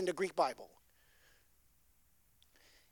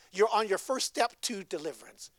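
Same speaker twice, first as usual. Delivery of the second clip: ordinary talk, nothing marked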